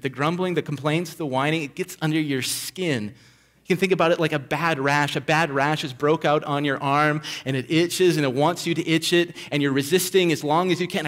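usual speaking voice

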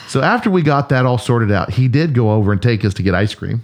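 usual speaking voice

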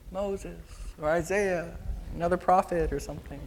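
sheepish, hesitant answer; unsure. Acted. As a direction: offer it tentatively, fading out. Disciples stumped, repeat weakly.

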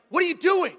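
high, loud, startled scolding; acted sharp telling-off; Holy Spirit. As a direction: cut in with an alarmed rebuke. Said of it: "It was like the Holy Spirit".

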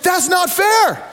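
high, slow shouted outrage; indignant shout. Retold or imitated. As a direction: yell "That's not fair." like a cheated team. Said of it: "And the Americans like".

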